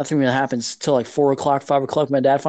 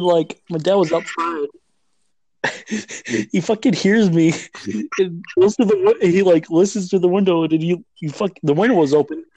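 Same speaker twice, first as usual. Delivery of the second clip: usual speaking voice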